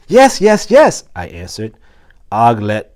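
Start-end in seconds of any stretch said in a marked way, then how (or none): 0.1-1.0 high, loud, excited yes-yes-yes; eager squeaky hamster. A narrator's reading. Hamster eagerly agreeing with Aldo, high and excited. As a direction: squeak it out in delight.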